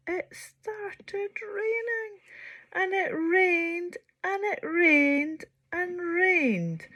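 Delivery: slow, stretched repetition; drawn out. A narrator's reading. Narrator stretching the endless rain, slow. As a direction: let the rain go on and on.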